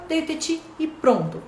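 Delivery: ordinary delivery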